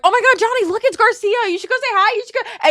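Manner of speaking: high, loud mock gushing; loud excited imitation. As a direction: imitate someone being embarrassingly loud. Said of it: "loud as fuck".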